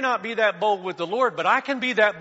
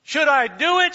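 mock shout from a cliff, louder and higher; 7 semitones higher, 7 dB louder than usual. Woman on a cliff shouting down to the camera, mock shout. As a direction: call it down from the cliff. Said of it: "she shouts".

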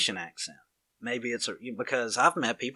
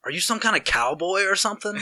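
higher, louder mock incredulity; retold incredulous question; 8 semitones higher, 5 dB louder than usual. Stranger asks if he's a cowboy, mock incredulous. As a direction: act out a baffled stranger sizing someone up.

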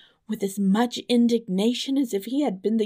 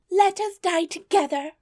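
very high, louder pleading voice; high, dramatic storybook dismay; distressed princess; 12 semitones higher, 5 dB louder than usual. A narrator's reading. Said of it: "continued she".

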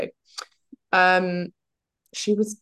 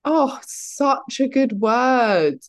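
slow, relishing delight; drawn out with pleasure; savoring the word; 8 semitones higher, 5 dB louder, much slower than usual. Real, not acted.

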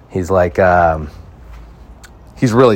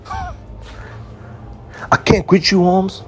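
mock movie line; 12 semitones higher, much slower than usual. Parodies the film line as a street guy.

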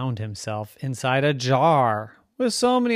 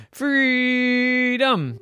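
high, loud, drawn-out cry; 12 semitones higher, 6 dB louder than usual. Acted. Rocky calls for freedom, crying out. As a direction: hold the word in a long yell.

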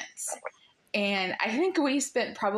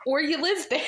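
higher-pitched words through a laugh; 6 semitones higher, faster than usual. Real, not acted. Host joking about learning a Welsh accent, laughing. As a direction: let a real laugh break into the line. Laughing at her own impossible task.

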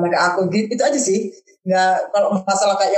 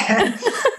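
quick high chuckle; short high laugh; 11 semitones higher than usual. Real, not acted. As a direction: laugh at her own joke. Laughing at five hundred perak.

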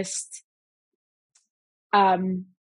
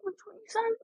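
much higher and softer, asking herself; doubtful murmur checking the wording; unsure questioning; 13 semitones higher, 11 dB quieter, faster than usual. Real, not acted. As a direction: float it up as an uncertain question. What is it?.

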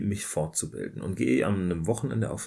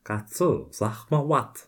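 talking to a cat; 3 semitones higher, 4 dB louder, much slower than usual. Real, not acted.

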